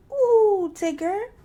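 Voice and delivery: high, loud delighted squeal. A narrator's reading. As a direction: squeal with joy. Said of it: "squealed Roo".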